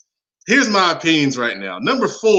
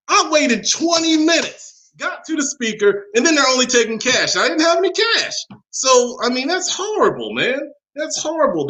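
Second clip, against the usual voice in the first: outraged disbelief; 9 semitones higher than usual. Real, not acted. Outraged about Popeyes service.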